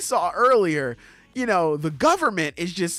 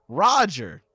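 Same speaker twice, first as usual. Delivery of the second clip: ordinary talk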